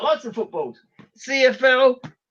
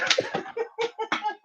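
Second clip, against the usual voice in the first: high, soft laugh; quiet giggle, well above speaking pitch; 13 semitones higher, 11 dB quieter than usual. Real, not acted. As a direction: run of high-pitched chuckles. Amused by his own stuff.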